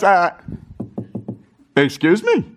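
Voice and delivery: high, playful mock puzzlement; Jesus butting in, hammed-up puzzled; curious Jesus. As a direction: butt in like a curious stranger. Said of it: "he goes".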